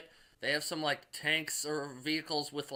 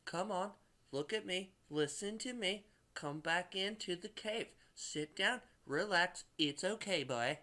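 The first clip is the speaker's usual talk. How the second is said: coaxing woman; 6 semitones higher than usual. Retold or imitated. Elizabeth calming Hulk in the cave, higher gentle coaxing. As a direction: soothe him like calming a scared dog. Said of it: "She's like".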